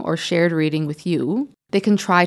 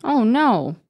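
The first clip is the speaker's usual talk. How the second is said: "Oh no." said high and lifted as an exclamation; 6 semitones higher than usual. Shown as a demonstration. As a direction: raise the voice on "Oh no.". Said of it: "makes my voice go up as well".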